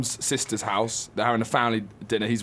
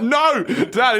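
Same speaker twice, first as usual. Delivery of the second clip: louder, much higher protest; whiny mock plea, raised; exasperated; 10 semitones higher, 4 dB louder than usual. Daughter voicing her mum pleading with her drunk dad, raised and high. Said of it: "She's like".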